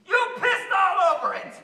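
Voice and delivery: loud, high accusing yell; angry outburst. Acted. Betrayed voter accusing the president, furious shout. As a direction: explode at him.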